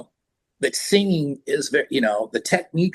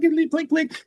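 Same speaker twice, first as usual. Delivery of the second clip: imitated clinks; slower than usual.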